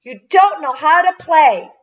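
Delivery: put-on high, loud child's outburst; shouted. A narrator's reading. Tara fed up with Albert's mistakes, shouting. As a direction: shout it angrily at a playmate. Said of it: "Then she shouted".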